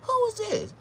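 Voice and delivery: high put-on woman's voice; mock woman. Woman not recognizing him. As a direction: ask it as the surprised girl. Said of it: "She was like".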